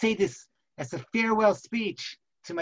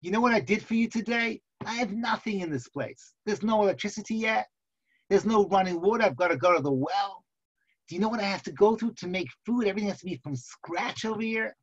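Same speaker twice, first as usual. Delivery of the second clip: higher-pitched, aggrieved litany of complaints; complaining wife; 3 semitones higher than usual. Acted. Wife lists daily chores to husband, indignant complaining. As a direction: pile up the grievances in a hammed-up whine. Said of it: "she starts complaining to him".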